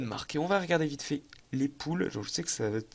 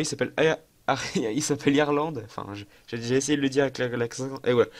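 ordinary talk

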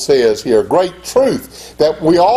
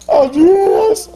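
mock surprised answer; 3 dB louder than usual. Retold self answering friends.

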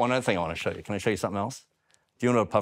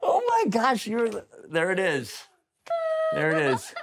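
excited; 9 semitones higher than usual. Real, not acted. Laughing delight at trick.

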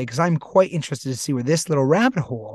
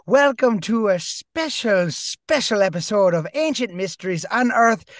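hammed-up Irish accent, pitched up; bad Irish accent; 4 semitones higher, 4 dB louder than usual. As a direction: greet everyone in a lilting, lovely bad Irish brogue. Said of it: "a lovely bad accent".

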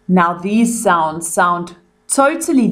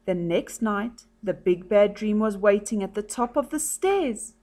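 softer storybook voice, lifting for worried lines; story reading to children; 9 dB quieter than usual. A narrator's reading. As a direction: read a picture book softly to children. Story narration.

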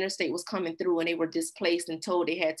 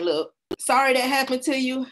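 higher, louder put-on apology; mock apology; 7 semitones higher, 5 dB louder than usual. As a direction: imitate an official saying sorry. Voicing the administration's apology.

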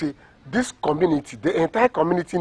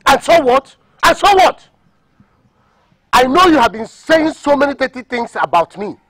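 loud, raised-pitch outburst; angry defiance; 5 semitones higher, 9 dB louder than usual. Acted. Seller accused of his brother's death, angry defiance. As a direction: shout down the accusers.

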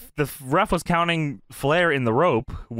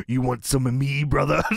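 lightly imitated wrestler challenge; Macho Man; 3 semitones lower than usual. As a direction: play a wrestler squaring up, lightly. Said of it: "Macho Man turns Norton around".

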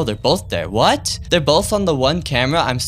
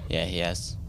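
ordinary talk, nothing marked

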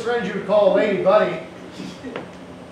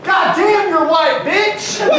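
laughing loud shout, pitched up; mock shout; 8 semitones higher, 3 dB louder, slower than usual. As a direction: yell it out in a hammy skit. Acted manager shouting.

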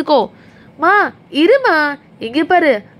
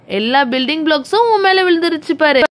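ordinary talk

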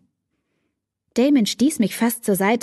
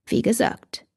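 whispered; 3 semitones higher than usual. A narrator's reading. Damon whispers his point to Katie again. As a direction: breathe the words like a secret.

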